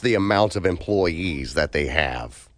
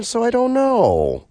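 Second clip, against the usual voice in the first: high squeaky voice; 3 dB louder than usual. Real, not acted.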